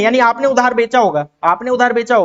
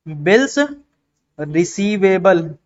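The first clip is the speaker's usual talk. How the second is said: slow; 3 semitones lower, much slower than usual. Shown as a demonstration. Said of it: "ये चार word तो आज के आज याद हो जाने चाहिए".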